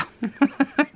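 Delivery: short high laugh; amused laugh. Real, not acted. Vlogger tickled by her struggle with a name, laughing. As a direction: laugh at your own stumble.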